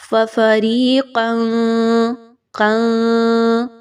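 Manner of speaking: فَفَرِيقًا with the tanwin hidden, nasal; ikhfa demo. Shown as a demonstration. Teacher shows the hidden tanwin. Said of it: "تنوین کی آواز کو چھپایا جا رہا ہے".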